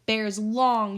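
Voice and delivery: usual speaking voice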